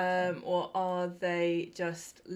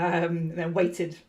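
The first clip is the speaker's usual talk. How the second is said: ordinary delivery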